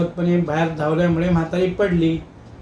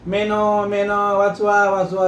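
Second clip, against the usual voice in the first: mock panicked cry, only a little raised; crying for help; 3 semitones higher, slower than usual. Shiva pinned in a leg lock, mildly crying for help. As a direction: ham up a choked plea for rescue. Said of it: "असं ओरडू लागला".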